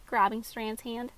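plain reading voice

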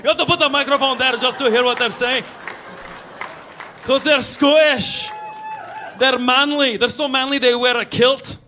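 loud, manly Scottish accent. Shown as a demonstration. Comic showing how manly Scots sound, loud Scottish accent. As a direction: belt it out in a broad Scottish accent. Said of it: "By the way, Scottish voice".

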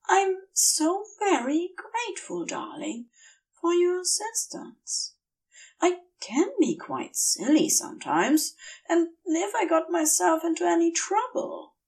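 grateful Aziraphale. Acted. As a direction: fuss over him gratefully.

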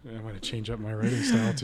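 normal talking voice

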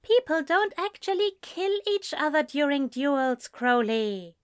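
high, reasonable reassurance; prim angel. Acted. Angel reassuring the demon duels are harmless. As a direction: talk him down primly.

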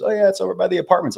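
quick, high imitation of a friend; imitating Asia. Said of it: "She goes".

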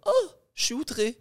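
high, soft mock outrage; mock outraged viewer. Viewer shocked by out-of-context TikTok, mock outrage. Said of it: "Ils sont comme".

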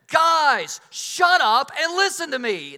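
loud, high yell to get attention; coach yelling. Retold or imitated. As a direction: bark it over the noise like a fed-up coach. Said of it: "not my best moment as a coach".

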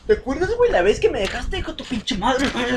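reclamo burlón de niño, mucho más agudo y fuerte; niño reclamando. Pretend. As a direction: burla de niño dolido. (amigo imitando al niño que reclama a su mamá, agudo y burlón)

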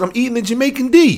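hint of a high, goofy friend voice; mock friend's answer. Friend on the phone answering, lightly voiced and high. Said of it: "He said".

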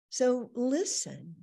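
drawn out, weighty invitation; slow down and say it firmly; slow emphatic. Real, not acted. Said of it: "slow emphatic cue".

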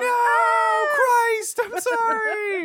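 high, shrill mock wail; anguished shriek. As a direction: cry out in mock horror. Mock anguished cry at gag.

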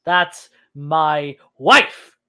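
slow, loud, word by word; emphatic, heated and drawn out; angry insistence. Acted. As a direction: spell it out with force. Half elf snaps back at healer.

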